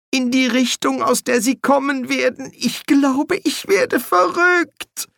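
very high, climbing, panicky voice; unhinged whimper. A narrator's reading. Bursar tells archchancellor he's going mad, whimpering.

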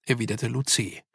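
plain reading voice

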